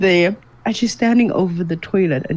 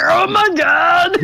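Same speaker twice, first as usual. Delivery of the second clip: high, slow, stretched-out shocked cry; shocked outcry; 12 semitones higher, 3 dB louder, slower than usual. Retold or imitated. Retelling to a friend her sleepwalking sister, shocked outcry. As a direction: gasp it out in disbelief. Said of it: "I was like".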